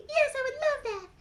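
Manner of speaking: a shy little puppet, thrilled; Mimi puppet voice. Acted. Puppet Mimi telling Ellen she loves new friends, high and eager.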